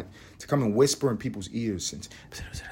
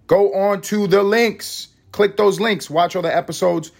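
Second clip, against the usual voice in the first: hyped plug; 9 semitones higher than usual. Acted. Hyped outro plugs.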